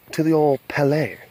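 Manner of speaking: "palette" said the French way; mock French pronunciation. Joking about how France says palette, mock French accent. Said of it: "as they would say in France".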